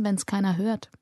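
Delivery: ordinary delivery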